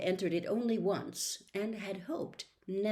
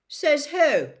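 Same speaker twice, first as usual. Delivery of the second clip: higher, loud retort; loud challenge; 9 semitones higher, 11 dB louder than usual. A narrator's reading. Dean loudly challenging Lockhart. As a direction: call out from the back. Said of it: "said Dean Thomas loudly".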